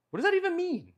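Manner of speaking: high squeaky what does that even mean; mock-indignant high-pitched question; baffled outrage. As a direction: throw the voice up in exasperation. Self-quote, baffled by deep freeze.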